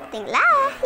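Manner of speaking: high squeaky "Tengoklah"; high playful exclaim. Pretend. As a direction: pitch it way up. Voicing a character aside.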